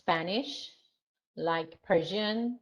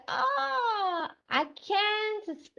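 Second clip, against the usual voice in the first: high, drawn-out wail of complaint; acted-out exasperated "ah"; dismayed groan of dislike; 12 semitones higher, much slower than usual. Shown as a demonstration. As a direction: whine it slowly, high, as if you can't bear it. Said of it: "he said".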